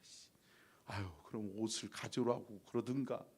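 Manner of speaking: muttered under the breath, soft and sulky; grumbling to himself on the cold mountain; inner grumble. Retold or imitated. Pastor grumbling inwardly on the climb, soft sulky mutter. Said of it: "속으로".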